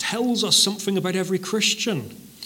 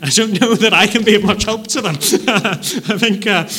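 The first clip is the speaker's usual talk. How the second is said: laughing; 5 semitones higher, 7 dB louder than usual. Real, not acted.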